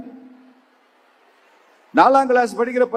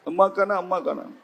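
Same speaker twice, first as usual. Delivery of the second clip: small, fading pretend whimper; mock crying; 4 dB quieter than usual. Boy keeps crying for lost mother, soft mock whimper. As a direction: imitate a child who keeps on sobbing. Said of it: "இவனுக்கு அழுக நிக்கல".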